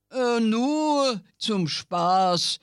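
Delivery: slow, drawn-out reassurance; slowly reassuring the suspicious God; reassuring devil. Acted. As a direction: soothe him, taking your time. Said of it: "versicherte der Teufel".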